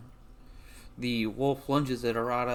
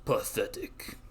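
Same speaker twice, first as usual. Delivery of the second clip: airy, unvoiced dismissal; scornful. Game master voices player's character dismissing a wolf's missed bite, scornful. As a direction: toss it off with contempt. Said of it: "You're just like".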